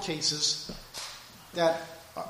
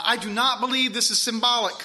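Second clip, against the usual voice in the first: usual speaking voice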